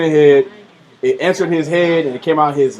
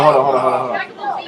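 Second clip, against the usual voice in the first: ordinary talk, nothing marked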